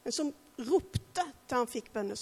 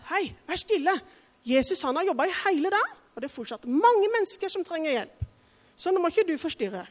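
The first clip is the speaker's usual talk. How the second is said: higher, louder, fast protective scolding; guard Jesus from the noisy beggar; overprotective Peter; 5 semitones higher, 4 dB louder, faster than usual. Retold or imitated. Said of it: "sa kanskje at".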